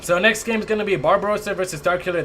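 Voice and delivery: usual speaking voice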